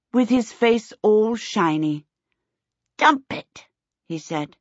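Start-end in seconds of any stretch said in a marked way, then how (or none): 3.0-3.7 put-on high toddler babble. A narrator's reading. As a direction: pipe it up high like a small child. Toddler speaking with buttery face.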